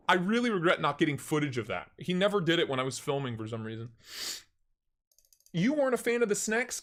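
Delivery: ordinary talk, nothing marked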